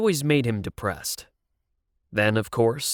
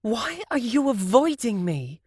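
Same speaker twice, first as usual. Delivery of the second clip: panting angry; 12 semitones higher than usual. A narrator's reading. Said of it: "she panted, angry".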